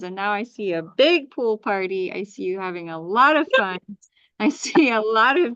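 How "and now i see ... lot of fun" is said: ordinary talk, nothing marked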